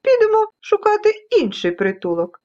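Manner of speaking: decide warily to move on; anxious little storybook creatures; worried dust specks. Acted. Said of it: "тривожилися вони".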